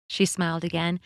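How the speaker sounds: plain reading voice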